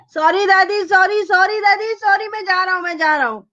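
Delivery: high, rapid wailed apologies; panicked crying out. Acted. Boy running from grandmother's blows, high panicked apologies. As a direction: gabble apologies while fleeing, high-pitched. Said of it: "he ran from this room screaming".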